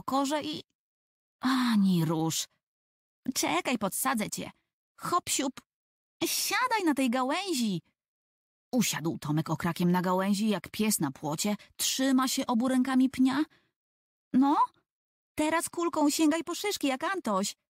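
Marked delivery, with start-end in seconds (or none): 3.3-7.8 put-on higher, bossy little girl coaxing; brisk higher girl's voice urging a little brother; Ewa's voice. Ewa voiced helping Tomek up. 14.3-17.6 put-on higher, eager little girl giving orders; higher child's commanding voice; bossy girl. Ewa instructing Tomek.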